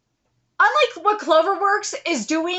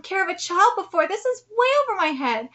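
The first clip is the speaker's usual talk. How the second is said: plain reading voice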